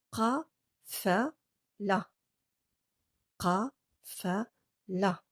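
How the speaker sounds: qafala drawn out very slowly; very slow model word. Shown as a demonstration. Teacher very slowly modelling word for repetition. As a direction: pull each syllable of qafala long for learners. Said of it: "Répétez après moi".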